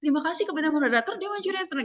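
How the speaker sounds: high-pitched, rushed debate opening; over-eager rising intonation. Shown as a demonstration. Overexcited debater greeting moderator and jury, high and rushed. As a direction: start far too high and race upward. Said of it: "intonasinya dari awal udah naik-naik tuh".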